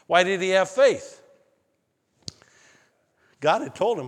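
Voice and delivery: ordinary talk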